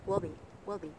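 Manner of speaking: "will be" run together as one short unit; quick reduced words. Shown as a demonstration. Teacher drilling weak words, quick "will be". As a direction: toss off "will be" lightly and quickly. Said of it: "xử lý rất là nhanh".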